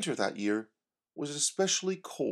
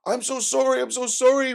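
much higher, louder panicked sorry; frantic apology; 12 semitones higher, 7 dB louder than usual. Acted. Oscar apologizes after bird attack.

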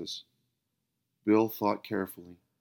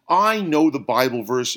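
loud, impatient cut-in; interrupting; 4 semitones higher, 9 dB louder than usual. Acted. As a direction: cut him off sharply. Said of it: "interrupted Bill".

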